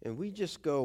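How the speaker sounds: usual speaking voice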